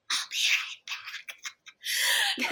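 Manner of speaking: very high, very slow breathy squeak; strangled squeak to her friend while panicking; tiny squeak. Retold or imitated. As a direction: squeeze it out in a strangled whisper. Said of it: "And I'm like".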